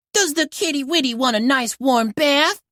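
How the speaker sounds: put-on high cooing baby talk. Rubble cooing to the kitten at the parlor in baby talk. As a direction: coo at the kitten like a toddler.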